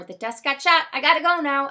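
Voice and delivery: high, loud announcement; hurried loud blurt. A narrator's reading. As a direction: blurt it out to end the call.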